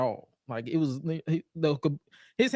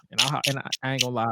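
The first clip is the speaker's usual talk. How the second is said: ordinary talk, nothing marked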